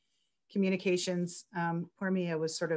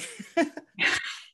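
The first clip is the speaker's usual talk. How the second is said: a high airy laugh at herself; high, breathless laugh; 10 semitones higher than usual. Real, not acted. Author laughing after reading her piece, high and airy.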